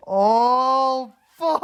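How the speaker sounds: high, drawn-out stunned "oh"; hammed-up blown-away reaction; mock awestruck. Retold awed reaction to profound quote.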